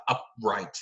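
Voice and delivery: ordinary delivery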